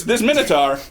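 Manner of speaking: usual speaking voice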